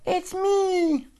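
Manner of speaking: high, tiny mouse voice; little voice. Acted. Mouse answering the old dog, tiny and high. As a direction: squeak it out small. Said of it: "came a little voice".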